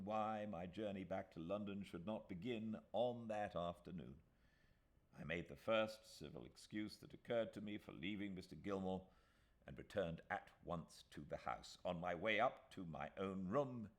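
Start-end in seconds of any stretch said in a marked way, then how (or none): none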